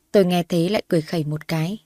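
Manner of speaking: usual speaking voice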